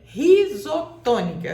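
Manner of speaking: "risotônica" escandido, com força no TÔ; pronúncia demonstrada. Shown as a demonstration. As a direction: separe bem as partes de "risotônica". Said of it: "assim que se diz". ("risotônica" bem articulado, sílaba tônica TÔ)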